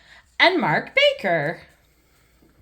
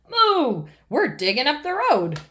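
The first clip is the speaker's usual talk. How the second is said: shouting bull; 5 semitones higher than usual. Acted. Mister Bull shouting to Mummy Pig over the digging. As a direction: call it out over the roadworks. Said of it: "shouts Mister Bull".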